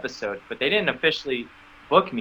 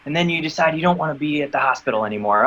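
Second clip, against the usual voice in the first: ordinary talk, nothing marked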